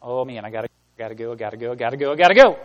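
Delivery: rushed hurry. Pretend. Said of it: "did not read the scripture like this".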